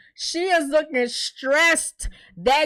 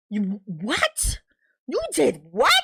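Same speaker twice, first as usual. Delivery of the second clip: put-on stunned voice; mock shock; 3 semitones lower, slower than usual. Bakari hearing Tiffany's confession, shocked put-on voice. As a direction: gasp out the disbelief.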